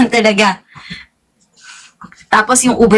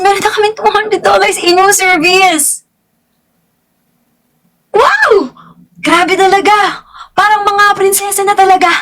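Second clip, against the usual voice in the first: higher, excited gasp of delight; thrilled; 7 semitones higher than usual. Real, not acted. Gushing to viewers about the free room service money, high and thrilled. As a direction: sound like a princess with free money. Thrilled at two hundred dollars.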